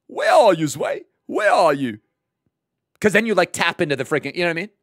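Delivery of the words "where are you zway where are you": calling out, the name squeaked very high; Auzio impression. Streamer calls for his friend, name squeaked high. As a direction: hunt for the friend. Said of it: "with an Auzio, you gotta say".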